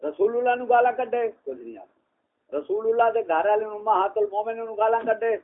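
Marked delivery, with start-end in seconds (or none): 1.4-2.6 low, slow, hushed mock order; sarcastic hush in a low voice. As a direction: drop right down, drawn-out and quiet. Mock order to stay silent.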